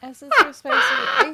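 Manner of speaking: playful shout. Real, not acted. Playful callout reading the stream.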